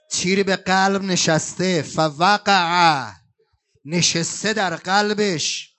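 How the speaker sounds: one word stretched very slowly; drawn-out lament. Acted. Eulogist telling Hussein's fall, very slow lament. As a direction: let the word fall like a sigh.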